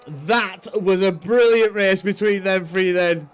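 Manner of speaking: ordinary talk, nothing marked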